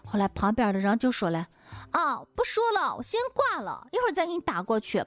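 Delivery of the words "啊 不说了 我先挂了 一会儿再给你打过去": voicing a stranger on the phone. Neighbor ends phone call.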